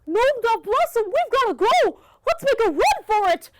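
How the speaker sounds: high, loud urgent call; urgent shout. Acted. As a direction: hurry them out, shouting. Herbert urges escape.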